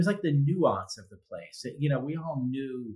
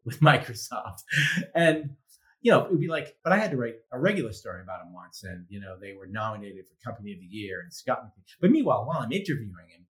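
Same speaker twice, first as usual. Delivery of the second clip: usual speaking voice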